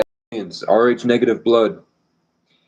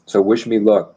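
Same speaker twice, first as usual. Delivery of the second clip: normal talking voice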